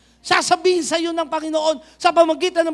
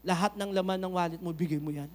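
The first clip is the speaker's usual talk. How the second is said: soft, much lower, hushed Lord's voice; voice of God; 8 semitones lower, 6 dB quieter than usual. Retold or imitated. Preacher voicing God asking for the whole wallet, hushed. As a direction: murmur it tenderly like a quiet command. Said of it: "Minsan mangungusap si Lord".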